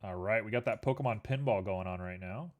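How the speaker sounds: ordinary delivery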